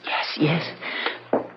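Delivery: faint whisper. Acted. Wife agreeing to leave husband.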